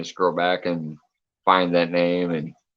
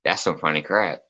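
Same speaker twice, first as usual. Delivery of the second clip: normal talking voice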